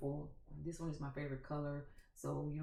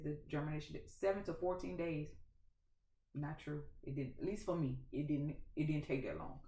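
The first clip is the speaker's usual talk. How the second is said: ordinary talk, nothing marked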